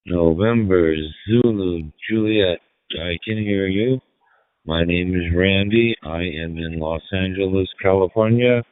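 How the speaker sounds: ordinary talk, nothing marked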